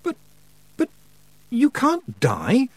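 high, drawn-out protest; bursting out. A narrator's reading. Pupil hears witch will die, bursting out. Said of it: "Tiffany burst out".

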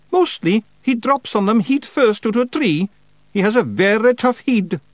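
high, loud Scots pictsie; cheeky wee Feegle. Acted. Feegle flatters the witch, cheeky.

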